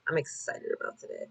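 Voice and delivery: breathy whisper to yourself; whispered affirmation. Shown as a demonstration. Closing her spoken affirmation for viewers in a whisper. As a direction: hush it like a secret promise. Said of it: "literally say that out loud".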